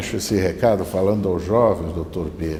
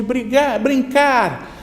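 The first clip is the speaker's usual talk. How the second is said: high, raised echo; echoed shout; 12 semitones higher, 4 dB louder, much slower than usual. Acted. Valley answers the boy's invitation, echoing call. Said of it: "lá no fundo responde".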